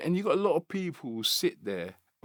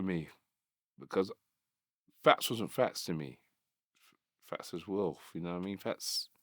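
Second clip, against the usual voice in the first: soft, choked-up remembering; holding back tears while speaking of a lost friend; real emotion, voice much softer; getting emotional; 4 semitones lower, 5 dB quieter than usual. Real, not acted. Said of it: "I'm kinda getting emotional".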